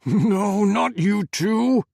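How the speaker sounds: high, loud, slow desperate pleading; panicked shout. Acted. Adam on the phone, begging Faisal not to die, panicked shout. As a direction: refuse to lose him, screaming. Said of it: "Panic had replaced Adam's normal steadiness".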